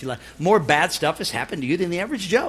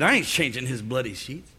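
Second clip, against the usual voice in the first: with attitude; 9 semitones lower, 6 dB quieter than usual. Retold or imitated. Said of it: "if she would have said with attitude".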